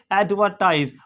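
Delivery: एडवर्टाइज with silent R and final Z sound; "AD-vuh-tize" for एडवर्टाइज, no R; British pronunciation. Shown as a demonstration. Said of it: "British English में नहीं पढ़ा जाता".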